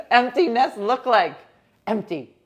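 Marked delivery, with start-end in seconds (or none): none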